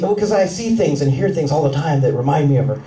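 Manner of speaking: normal talking voice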